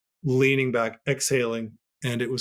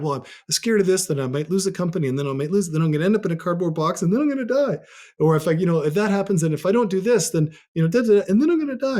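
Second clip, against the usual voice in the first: spiralling panic; 5 semitones higher, faster than usual. Retold or imitated. Retelling spiralling fearful thoughts.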